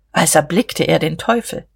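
usual speaking voice

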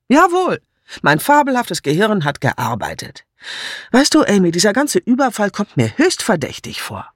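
emphatic, high-pitched opening; self-satisfied amateur sleuth. Acted. Woman sharing her suspicion with Amy, pleased and emphatic. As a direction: announce your deduction, pitch swooping down.

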